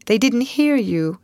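plain reading voice

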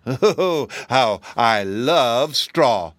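loud, very slow relish; gloating beast. A narrator's reading. Hungry beast facing the straw-stuffed Scarecrow, gloating. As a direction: drool over every drawn-out word.

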